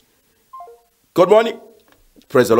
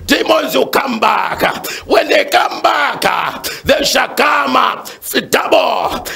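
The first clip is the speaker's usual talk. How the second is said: full-force preaching; 7 semitones higher than usual. Real, not acted. Pastor warning God's children about demons, fervent high-pitched preaching. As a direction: preach it hard, each phrase pushed out and falling away.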